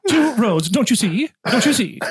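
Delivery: D A Carson impression. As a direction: lightly mimic a preacher driving home a point. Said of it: "It's our D A Carson impression".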